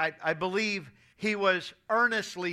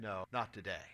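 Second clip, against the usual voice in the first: weak refusal; 10 semitones lower, 11 dB quieter than usual. Retold or imitated. Dying grandfather refuses, weakly.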